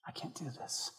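breathless unvoiced whisper; whispered surrender. A believer surrendering in prayer, in a whisper. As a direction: confess it in a whisper.

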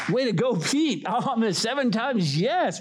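high-pitched, impressed congratulation; mock cheering. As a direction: cheer him on like an admiring crowd. Acting everybody cheering Pete on.

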